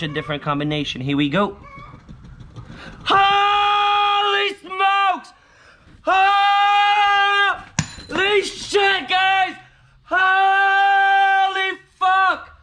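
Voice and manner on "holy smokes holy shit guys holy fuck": high, loud, stretched-out yells of joy; ecstatic shouting. Real, not acted. Scratching a winning ticket, yelling to viewers in ecstatic joy. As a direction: scream it like you just won big. Lottery scratch-off win, screaming.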